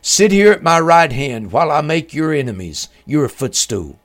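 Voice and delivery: ordinary delivery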